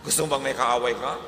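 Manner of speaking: ordinary delivery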